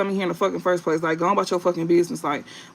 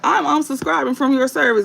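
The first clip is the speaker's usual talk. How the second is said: lightly mocking, higher-pitched quote; mock complainer; 6 semitones higher than usual. Mimicking a viewer who threatens to unsubscribe, lightly mocking. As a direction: imitate a self-important customer, pitched up.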